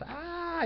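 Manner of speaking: chillido muy agudo y sostenido, sin fuerza; grito de pánico imitado. Acted. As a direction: imita a los venados que huyen chillando. Venados huyen gritando. (grito agudo fingido)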